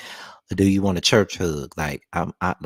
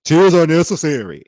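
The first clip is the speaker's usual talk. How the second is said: higher, louder mock-preachy voice; mocking commenter; 10 semitones higher, 7 dB louder than usual. Co-host mocking a preachy comment, high sarcastic. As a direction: parody a self-righteous commenter. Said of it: "please don't start the B S with me".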